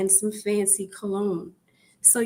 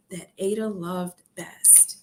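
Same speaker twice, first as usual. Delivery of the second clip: whisper; 3 semitones lower, 4 dB quieter than usual. A narrator's reading. Narrator whispering to the listening kids. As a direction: whisper it like a secret.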